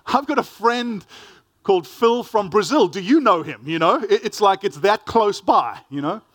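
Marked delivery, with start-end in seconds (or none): 0.1-3.6 hammed-up clueless friendly asker; lightly mocking an eager, clueless stranger. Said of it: "the conversation after that ensues".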